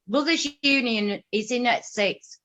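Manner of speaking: ordinary delivery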